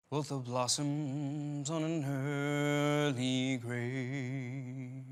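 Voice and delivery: slow, hushed dramatic poem reading; solemn recitation. Acted. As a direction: recite each line slowly, like a eulogy.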